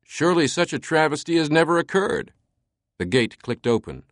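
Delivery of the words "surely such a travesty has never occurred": mock indignation. A narrator's reading. Langdon plays along theatrically.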